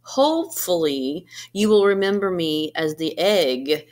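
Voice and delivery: animated picture-book narration with gentle pauses; storybook reading. Acted. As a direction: read it like story time for a class. Reads picture book to students.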